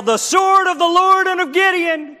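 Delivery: 高声呐喊，声音拔高很多; 像举着火把冲锋那样喊出来; 战斗呐喊. Acted. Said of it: "And they yell".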